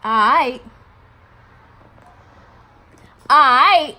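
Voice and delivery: loud, rising pitch on a drawn-out mimic; incredulous mocking repeat. Teller mocks his one-word response, loud and indignant.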